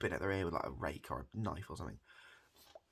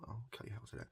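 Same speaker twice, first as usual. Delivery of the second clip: whisper; 3 semitones higher, 7 dB quieter, much faster than usual. Real, not acted. Whispered aside to listener.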